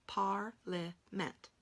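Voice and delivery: parliament spoken very slowly, each part clear; slow sounding out. Shown as a demonstration. Teacher slowly saying parliament for spelling. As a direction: stretch out parliament. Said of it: "How about parliament?".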